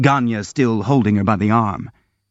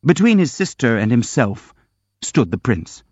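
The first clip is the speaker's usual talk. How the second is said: plain reading voice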